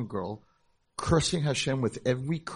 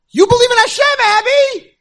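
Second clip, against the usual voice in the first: very high, very loud proclamation; triumphant shout; 19 dB louder than usual. Retold or imitated. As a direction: declare it like a winning blow. Climax of his argument.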